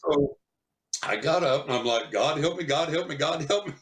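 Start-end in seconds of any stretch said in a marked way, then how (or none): 2.1-3.7 higher, urgent mock pleading; hammed-up desperate prayer; frantic plea. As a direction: beg heaven in a retold panic. Retold frantic plea while running.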